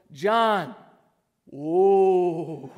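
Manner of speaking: loud drawn-out "Whoa" of amazement; mind-blown John. Shown as a demonstration. Pastor leading the congregation in a mind-blown "John, whoa". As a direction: say "John" then a big awed "Whoa". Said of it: "So for John, we go".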